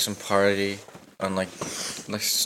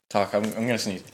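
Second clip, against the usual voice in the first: normal talking voice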